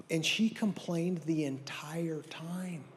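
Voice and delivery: hushed whisper. Acted. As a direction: whisper it like a secret. Whispered aside about complaining wife.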